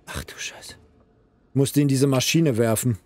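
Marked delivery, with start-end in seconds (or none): 0.1-0.8 breathless whispered curse; whispering. Real, not acted. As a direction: hush it under your breath. Sudden scare in horror game.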